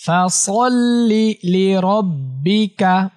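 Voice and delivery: slow melodic chant, long vowels held; slow tajweed recitation drill; Quran recitation. Shown as a demonstration. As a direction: chant each phrase for pupils to repeat. Recites the full verse.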